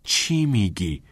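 slow, drawn-out question; stretched, doubtful query; puzzled. A narrator's reading. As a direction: ask in slow bafflement. Puzzled by friend's joke.

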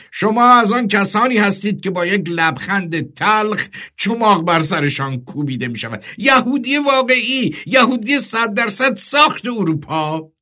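high, emphatic commander's taunt; فرمانده بلوک. Acted. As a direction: jeer at the captive, voice pitched well up.

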